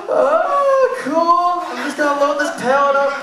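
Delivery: high, loud, pinched dismissal; strained brush-off. Retold or imitated. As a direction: blurt it out while clenching. Brushing off boring coworker.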